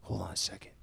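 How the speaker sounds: unvoiced whisper, stopping yourself; whispered realization. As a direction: whisper it as an idea lands. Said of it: "I'm like".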